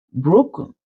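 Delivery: plain reading voice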